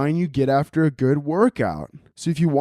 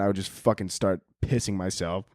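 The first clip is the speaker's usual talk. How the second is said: ordinary talk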